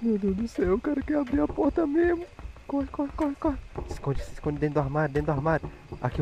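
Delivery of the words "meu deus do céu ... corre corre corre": high, soft frantic panic; panicking gamer. Real, not acted. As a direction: panic as the door opens. Monster opening door.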